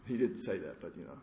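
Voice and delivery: normal talking voice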